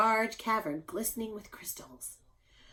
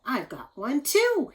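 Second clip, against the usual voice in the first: higher, louder, a little slow; delighted Paul; 5 semitones higher, 5 dB louder than usual. Acted. Paul showing his own wishbone to Carrie, delighted. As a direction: grin through it. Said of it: "he said".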